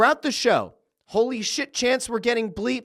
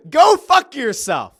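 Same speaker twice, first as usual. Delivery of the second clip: shouted, high, slowing to a taunting laugh; loud defiant taunt to a rival, slowing as it lands; 8 semitones higher, 9 dB louder, slower than usual. Retold or imitated. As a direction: yell it right in his face, then savour the insult. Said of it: "And then Cody says".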